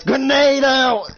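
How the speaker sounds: high, loud, long-drawn battle call; shouted warning. Acted. Woman throwing a grenade in combat, shouted warning.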